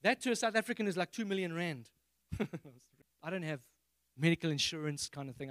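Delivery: usual speaking voice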